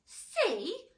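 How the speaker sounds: loud, high indignant shout. A narrator's reading. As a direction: yell it in outrage. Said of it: "shouted the poor earthworm".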